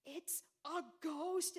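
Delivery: a touch of fear, drawn out; spooked. Acted. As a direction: disciple gasping it's a ghost. Frightened disciple spots apparition.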